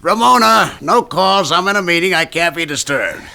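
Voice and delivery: loud, high call to the secretary across the office. Acted. Boss to his secretary, calling out loud. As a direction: bark the order through the door.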